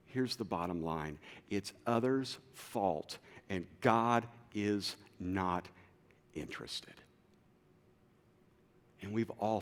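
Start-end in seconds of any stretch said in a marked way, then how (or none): 1.5-6.9 slow, dropping to a low soft murmur; weary slow trail-off; slow fading resignation. Acted. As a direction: let it sink and fade. Bottom line, slowing and fading.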